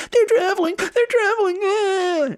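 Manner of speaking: mock their complaint in a very high squeal; whiny team. Mocking the team that cried traveling, high-pitched whining. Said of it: "that team that was all like".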